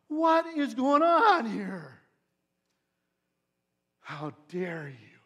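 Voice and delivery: loud, slow, thundering outrage; outraged Jesus. Acted. Jesus confronting the money changers in the temple, outraged. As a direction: let righteous fury roll out, very slow and loud. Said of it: "He is outraged".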